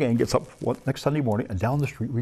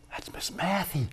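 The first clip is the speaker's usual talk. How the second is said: surprised gasp; 5 semitones higher, 5 dB quieter, slower than usual. Retold or imitated. Recognizing his old teacher.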